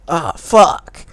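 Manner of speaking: high, loud mock cry of pleasure; overplayed parody moan; loud fake moan; hammed-up moan. Reading smut in hammed moan.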